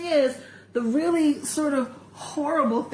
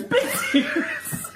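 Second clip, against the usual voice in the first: "big tears" pitched much higher through laughter; laughing mimicry. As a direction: crack up mid-imitation, voice jumping higher. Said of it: "with this toilet paper going".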